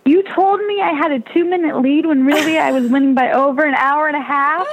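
high, rising mock disbelief; incredulous. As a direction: replay your stunned protest at the lie. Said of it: "I was like".

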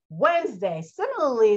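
usual speaking voice

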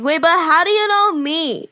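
put-on high, slow, confused drunk voice. Drunk girl questioning a stranger, high drawn-out voice. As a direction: drag it out like a puzzled drunk. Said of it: "And I'm drunk. I'm like".

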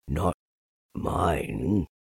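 low donkey, a touch of pride; lightly proud, kept low; proud Eeyore. A narrator's reading. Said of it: "said Eeyore proudly".

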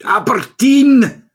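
loud, high, drawn-out fake Scottish cry; mock Scottish accent. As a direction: belt it out in a hammed-up, badly faked Scottish accent. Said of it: "do that Scottish accent".

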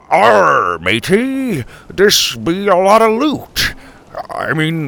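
loud, high, badly faked pirate talk; hammed-up pirate. Grandpa bluffing the crewmate, hammed-up pirate voice. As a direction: ham it up as an eager fake pirate.

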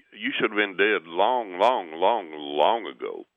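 slow, each "long" drawn out; emphatic verdict. Retold or imitated. Gas men tell homeowner he should be dead, emphatic verdict. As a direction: lean slowly on every repeated "long".

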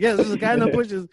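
high, loud insistent answer; acted emphatic yes, falling at the end; exasperated confirmation. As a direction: confirm it impatiently, imitating a panicked caller. Caller confirms to front desk.

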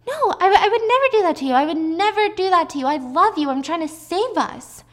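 high, loud, frantic denial; desperate protest. Acted. Wife insisting to her husband she loves him, desperate.

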